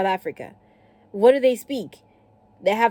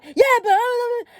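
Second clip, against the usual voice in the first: high, loud, garbled mock yell; hammed-up hollering; imitated shouting; 5 dB louder than usual. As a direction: shout back like the indignant driver. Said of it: "You don't need to shout".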